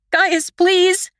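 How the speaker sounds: high, pleading, breaking voice. A narrator's reading. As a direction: beg him to wake, voice cracking. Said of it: "My voice trembles and breaks".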